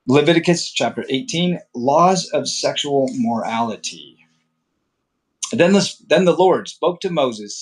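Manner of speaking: measured, formal Bible reading voice; scripture reading. Acted. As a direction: read the law as holy text, steady and solemn.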